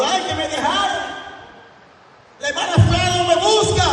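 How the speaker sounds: very high wailing imitation of a complainer; hammed-up tearful lament, way up high; mock crying complaint. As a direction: wail it out like someone feeling abandoned. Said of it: "Y tú a veces llorando".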